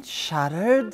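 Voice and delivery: plain reading voice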